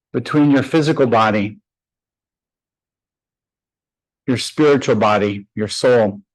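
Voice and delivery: unhurried, even meditation voice with long pauses; guided meditation. Acted. Yoga teacher guiding her class to close their eyes, calm meditation voice. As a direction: ease the class inward, one phrase at a time.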